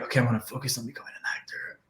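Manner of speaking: fast, breathy whisper to himself; whispered thought. Retold or imitated. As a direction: hush it like a private decision. Inner thought voiced as quote.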